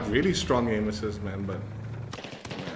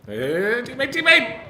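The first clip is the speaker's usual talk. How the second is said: high, loud shout at a teammate; calling out; 12 semitones higher, 8 dB louder than usual. Real, not acted. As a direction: yell to get a teammate's attention.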